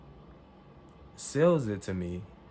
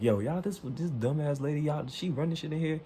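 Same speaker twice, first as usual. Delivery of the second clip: higher-pitched indignant rant; retold flipping out; 7 semitones higher than usual. Angry customer complaining to everyone about the clerk, flipping out. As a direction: rant to the whole shop, worked up. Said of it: "flipping out now".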